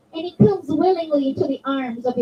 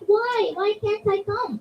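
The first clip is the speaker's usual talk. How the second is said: put-on higher-pitched voice of a young daughter begging; pleading little girl; 6 semitones higher, 3 dB quieter than usual. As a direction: ask like a small girl pleading with her father.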